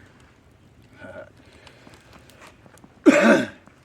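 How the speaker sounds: big loud burst of laughter. Real, not acted. Laughs at being hammered drunk.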